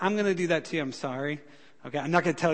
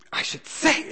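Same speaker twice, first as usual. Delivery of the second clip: offended retort; 12 semitones higher, 4 dB louder, slower than usual. Retold or imitated.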